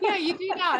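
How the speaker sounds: high-pitched reply through real laughter; laughing. Real, not acted. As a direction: laugh as you playfully agree, pitch jumping up. Friendly teasing amid shared laughter.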